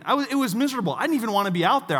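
ordinary talk, nothing marked

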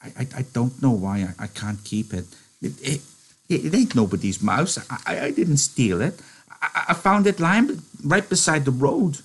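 stammering, high pleading with a break in the voice. Acted. Lenny pleading with George over the mouse, voice breaking. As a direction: stutter out a frightened excuse. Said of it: "His voice broke a little".

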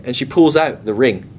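normal talking voice

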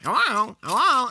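high squawky parrot hello. Retold or imitated. Imitating the parrots for a friend, high parrot voice. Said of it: "saying stuff like".

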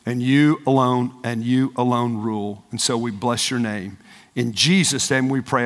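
low pastoral prayer after preaching; congregational prayer. Real, not acted. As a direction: lead the room in earnest prayer.

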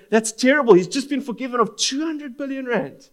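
high, indignant outburst; appalled outrage. Acted. Listeners reacting to the wicked servant, appalled outrage. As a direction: cry it out in disbelief at the injustice. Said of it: "our stomach churns within us".

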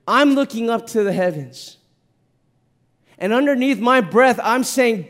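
high, loud, emotional preacher; impassioned preaching. Acted. Preacher tells church about his crying son, impassioned.

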